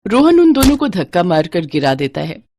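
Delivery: plain reading voice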